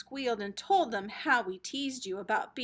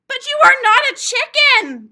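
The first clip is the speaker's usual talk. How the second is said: high, very loud scream; big sister screaming at her stubborn sibling; 11 semitones higher, 17 dB louder than usual. Acted. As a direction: scream it in frustration. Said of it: "Kim screamed".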